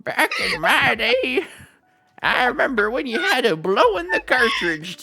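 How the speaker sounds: put-on high, loud old-timer voice; hammed-up old geezer reminiscing; mock old-timer. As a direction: crank the voice up high like an old codger.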